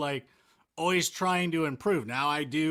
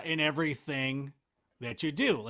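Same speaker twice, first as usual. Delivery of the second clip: ordinary talk, nothing marked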